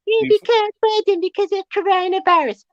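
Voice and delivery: high, whiny mocking voice of the club owners; sneering high parody of a lame excuse. Owners excusing themselves to fans, mocked in a high whine. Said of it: "I don't wanna hear".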